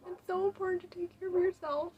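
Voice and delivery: pleading through real tears; real crying. Real, not acted. Urging viewers to self-care, really crying. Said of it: "I don't know why I'm getting emotional".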